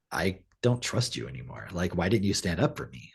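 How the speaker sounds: low, subdued retold reproach; imitating wounded distrust, hushed; quiet hurt. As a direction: say it softly, as a let-down husband. Retold husband, hurt, confronting wife.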